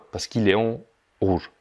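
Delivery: ordinary delivery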